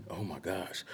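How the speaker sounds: hushed, overwhelmed disbelief; a retold gasp of wonder, whispered; awed whisper. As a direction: breathe it out in a stunned whisper. Overwhelmed by friends' love.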